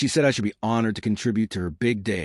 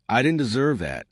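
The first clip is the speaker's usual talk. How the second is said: ordinary delivery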